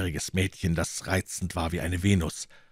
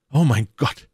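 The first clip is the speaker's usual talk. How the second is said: high, loud gasped exclamation; appalled outburst, pitch jumping up; horrified cry; 6 semitones higher, 8 dB louder than usual. A narrator's reading. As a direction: cry out, stunned with horror. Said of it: "rief D'Artagnan, ganz betäubt vor Schrecken".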